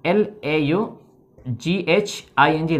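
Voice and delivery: letters spelled out slowly, one by one; spelling aloud. Shown as a demonstration. (अक्षर-अक्षर धीरे बोलकर)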